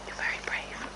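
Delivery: whispered praise. Real, not acted. Rider soothing a nervous horse, soft whisper. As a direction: breathe the words softly.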